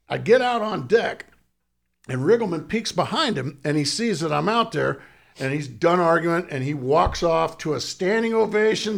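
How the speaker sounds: ordinary talk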